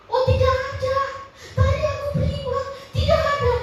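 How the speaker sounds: suara melonjak tinggi dan keras karena heran; kaget. Retold or imitated. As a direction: serukan dengan heran. Staff surprised the old man vanished. (pegawai toko heran kakek itu hilang, nada tinggi)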